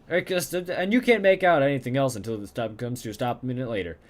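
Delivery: ordinary delivery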